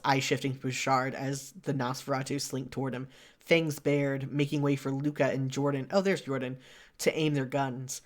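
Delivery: usual speaking voice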